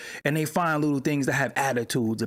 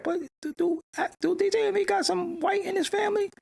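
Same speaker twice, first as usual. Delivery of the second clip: much higher, mock-amazed asking; incredulous question; 13 semitones higher than usual. Real, not acted. Streamer asks chat about Envy's family, amazed. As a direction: ask it in high disbelief.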